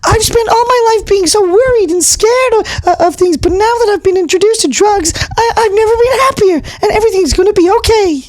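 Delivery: high-pitched nervous mouse puppet; squeaky puppet mouse. Acted. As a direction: gush about his new life.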